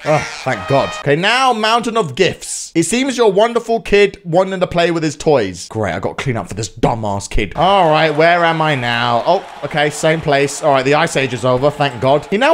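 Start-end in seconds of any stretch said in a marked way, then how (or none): none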